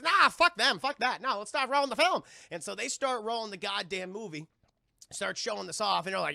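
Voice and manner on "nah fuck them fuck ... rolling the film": loud, raised-pitch mock impatience; acted bossy outburst; brusque dismissal. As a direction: wave off the objection forcefully. Director brushes off crew's caution.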